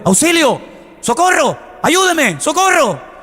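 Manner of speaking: high-pitched calls of a drowning man; imitated cries for help. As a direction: call out for rescue, acted without extra volume. Imita al hombre que se ahoga. (gritos de socorro imitados, en tono agudo)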